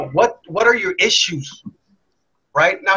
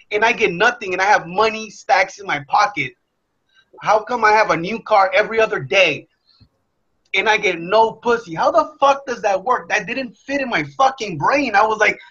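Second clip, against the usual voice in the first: ordinary talk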